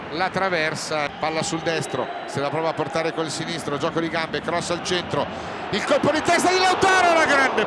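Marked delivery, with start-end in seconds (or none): 5.7-7.6 excited shout. Real, not acted. Header chance, commentator yells.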